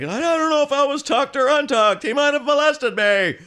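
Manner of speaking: high, loud, ditzy voice of a confused hygienist; dazed hygienist. Retold or imitated. Actor voicing a woozy hygienist in a Seinfeld scene, high and loud. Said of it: "like a penthouse pet hygienist".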